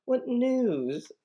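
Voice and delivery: sugary, high-pitched wheedling; buttering up. Acted. Juliet sweet-talking her nurse for news, buttering up. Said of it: "She knows how to butter her up".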